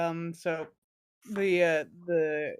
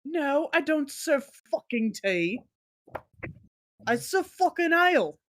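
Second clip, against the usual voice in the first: high, cursing tavern keeper in a put-on accent; gruff-tempered bartender; 6 semitones higher than usual. Bartender refusing to serve tea to customers, gruff bartender role. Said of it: "the bartender looks at you and is like".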